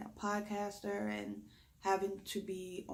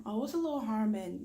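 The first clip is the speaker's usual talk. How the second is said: mock dismissive; 4 semitones higher, 6 dB louder, faster than usual. Mocking others' dismissive excuse.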